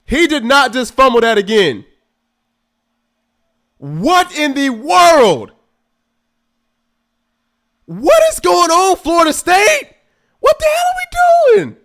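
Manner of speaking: loud, high furious disbelief; exasperated yelling. Real, not acted. Fan yelling at Florida State after another fumble, furious. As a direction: shout at the screen in outrage.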